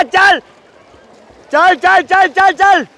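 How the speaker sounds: loud high cheering at the pool. Real, not acted. Parent cheering a swimmer at the race, shouting. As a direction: shout him on down the lane.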